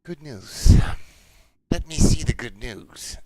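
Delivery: loud, sharp sarcastic snap; angry sarcastic Broodmother. Acted. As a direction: bite the words out like a furious chieftain. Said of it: "a very angry expression on her face".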